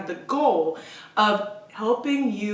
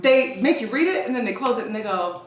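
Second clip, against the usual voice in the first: ordinary delivery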